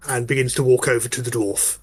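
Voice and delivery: normal talking voice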